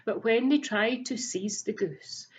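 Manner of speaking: ordinary delivery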